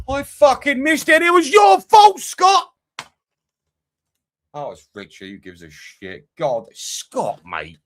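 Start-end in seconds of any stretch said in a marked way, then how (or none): 0.1-2.7 high, loud furious yell; real frustrated shout; angry shout. Real, not acted. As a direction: scream it at your mate for making you miss it. Missed goal, blames chat mate.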